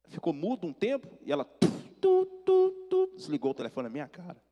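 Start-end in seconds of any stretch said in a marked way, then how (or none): 1.4-3.1 high, loud monotone phone beeps; imitated dial tone. Son cut off by mother, mimicked disconnect tone. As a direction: mimic a hung-up line, beep by beep.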